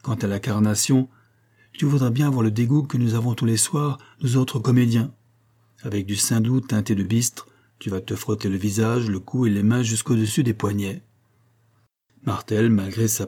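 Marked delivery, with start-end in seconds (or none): none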